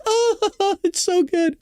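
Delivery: high, gushing parody of a fan; swooning mock praise, pitched high; mock adoring readers. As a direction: pretend to lap it up, laughing. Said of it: "They're just reading this, lapping it up".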